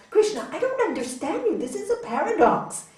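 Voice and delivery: high, bewildered protest to the master; acted exasperated confusion; totally confused. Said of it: "totally confused".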